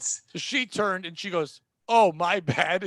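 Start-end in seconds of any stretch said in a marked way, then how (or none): 1.9-2.8 loud, comic backing-off apology; exaggerated oops reaction; mock hasty apology. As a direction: imitate an embarrassed customer retreating. Customer backing off, embarrassed.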